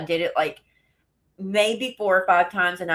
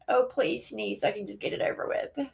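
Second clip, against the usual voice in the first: ordinary talk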